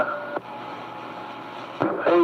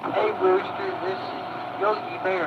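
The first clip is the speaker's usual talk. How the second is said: higher, softer cartoon bear voice; Yogi Bear voice; 7 semitones higher, 7 dB quieter than usual. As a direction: ham up a cartoon bear on the radio. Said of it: "you sounding like Yogi Bear".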